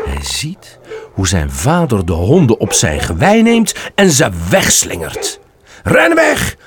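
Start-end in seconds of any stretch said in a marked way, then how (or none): 5.8-6.6 high, urgent call to flee. Acted. Father shouting again at his frozen fawn to run. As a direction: shout the warning once more. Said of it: "roept zijn vader opnieuw".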